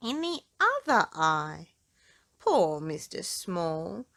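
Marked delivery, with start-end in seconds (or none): none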